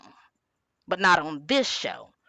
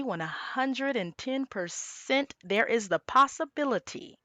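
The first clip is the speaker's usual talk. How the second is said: normal talking voice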